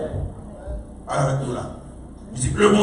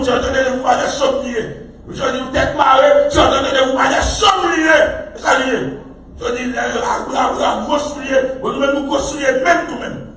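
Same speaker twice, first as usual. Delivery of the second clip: repeated refrain, higher and rising; preaching refrain; 4 semitones higher than usual. Real, not acted. Preacher pressing psalm verse on congregation, chanted refrain. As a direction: drive the verse home again and again.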